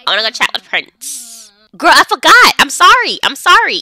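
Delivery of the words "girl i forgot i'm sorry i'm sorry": loud apology. Acted. Apologising to a hungry sim.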